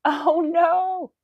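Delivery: high, loud wail of oh no; loud cry of dismay. Pretend. Streamer playing along with a spider scare, wailing. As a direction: belt it out in mock horror.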